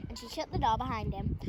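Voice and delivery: ordinary delivery, nothing marked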